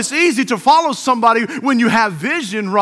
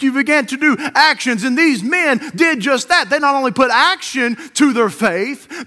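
normal talking voice

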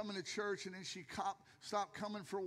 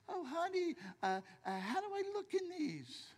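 higher, lightly put-on wife asking sweetly; 6 semitones higher than usual. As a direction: ask like a wife fishing for a compliment. Wife voiced trying on pants.

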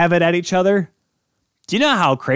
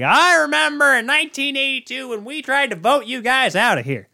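put-on Southern complainer, pitched way up; full Southern accent; 11 semitones higher than usual. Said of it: "I don't know why I went full Southern".

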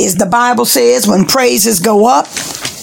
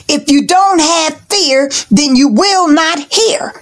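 normal talking voice

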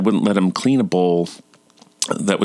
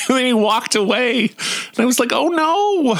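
much higher pitch, amused; tickled, laughing delivery. Real, not acted. Dad telling how son walked away, high and tickled. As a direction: squeal it out through leftover laughter.

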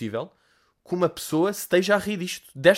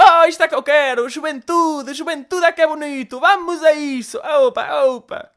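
put-on high, loud old lady squeal; hammed-up excited granny voice; excited old woman; 12 semitones higher, 8 dB louder, slower than usual. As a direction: play a giddy toothless old woman, pitched way up. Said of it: "uma velha desdentada, toda excitada".

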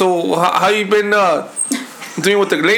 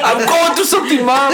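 put-on higher, laughing whiny teen; 6 semitones higher than usual. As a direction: play a sulky teenager. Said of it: "a phase?".

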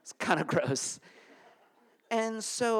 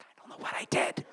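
hushed helpless reply; bewildered whisper. Retold or imitated. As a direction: whisper it, lost and confused. Confused teen retold reply.

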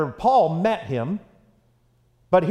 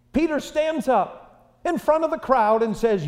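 normal talking voice

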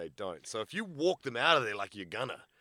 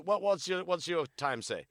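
normal talking voice

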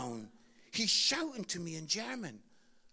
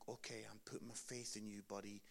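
quiet; 12 semitones lower, 16 dB quieter than usual. Retold or imitated. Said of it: "I'm like".

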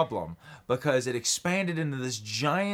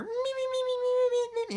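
tiny squeaky voice, way up high. Retold or imitated. Friend mimicking a tiny sidekick in a squeaky voice.

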